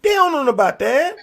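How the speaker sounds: high, loud incredulous objection; emphatic protest. Real, not acted. Speaker defending unchurched kids, high emphatic protest. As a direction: push it up high in disbelief.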